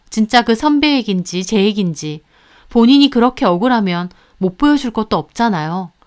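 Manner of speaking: ordinary delivery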